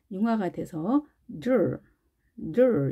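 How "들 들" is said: 들 as one English "dr", D melting into R; fused DR. Shown as a demonstration. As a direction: blend 들 between D and "j", with curled R. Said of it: "이렇게 발음됩니다".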